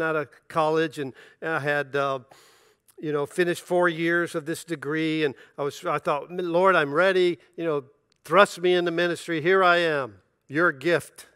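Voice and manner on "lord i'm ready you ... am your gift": a proud, mock-grand offer to God; eager mock prayer. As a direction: play the over-keen young preacher offering himself. Eager prayer to the Lord, retold.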